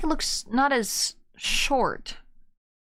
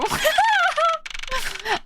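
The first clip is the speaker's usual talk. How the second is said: high laugh. Real, not acted. Laughing at character.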